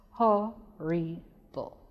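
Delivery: "Horrible" slowly split into three separate syllables. Shown as a demonstration. Teacher breaking "Horrible" up for pupils, slow and separated. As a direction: break "Horrible" apart, pausing after each syllable. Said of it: "When we break it".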